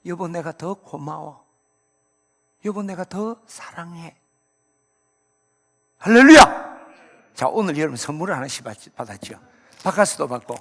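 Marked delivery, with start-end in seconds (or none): 0.1-4.2 조용하고 느리게, "여보"에 힘을 주어 다정하게; 배우자에게 미안함과 고마움을 부드럽게 전하듯; 다정한 답. Acted. Voicing spouse's tender reply. 6.0-6.8 "할렐루야"를 아주 크고 높게 외친다; 온 회중이 따라 외치도록 터뜨려라. Real, not acted. Rousing call to congregation.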